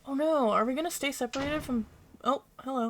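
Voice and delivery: ordinary talk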